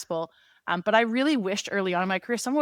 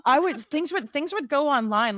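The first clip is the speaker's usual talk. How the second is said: fired up; 7 semitones higher, 3 dB louder than usual. Real, not acted. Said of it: "Sorry, now I'm getting fired up".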